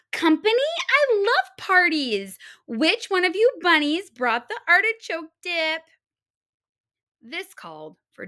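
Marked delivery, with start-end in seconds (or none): 0.1-5.8 higher, louder and fast, ordering everyone about; a pushy guest barking orders at her hosts; bossy spoiled big girl. Acted. The big little girl bossing the hares for pillows, high and loud.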